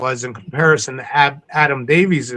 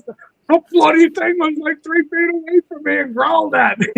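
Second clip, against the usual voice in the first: imitating an English accent, pitched much higher; [name] English accent; 13 semitones higher than usual. Adam describing the Sasquatch to the crew, in an imitated English accent. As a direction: put on a jokey English accent to retell the scare. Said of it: "this English accent".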